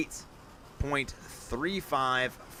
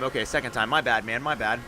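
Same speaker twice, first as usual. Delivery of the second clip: normal talking voice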